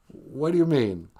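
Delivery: ordinary talk, nothing marked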